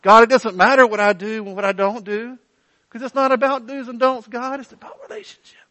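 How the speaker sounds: high, mocking wheedle that trails into a breathy whisper; mock excuse to God. Preacher mocking a believer excusing himself to God, high wheedling voice. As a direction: play a glib believer brushing God off. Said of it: "The idea that we would treat God that way".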